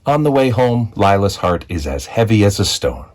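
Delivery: usual speaking voice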